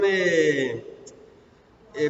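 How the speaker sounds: ordinary talk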